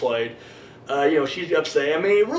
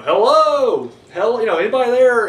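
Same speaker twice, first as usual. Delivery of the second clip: calling out; 7 semitones higher, 7 dB louder than usual. Retold or imitated. Lone man calling through empty London.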